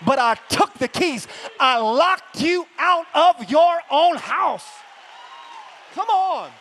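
high, loud shout building to a cry; full-force preaching. Acted. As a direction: preach it like victory.

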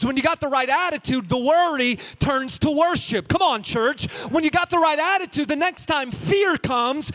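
loud, high, full-force preaching. Acted. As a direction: whip the church up.

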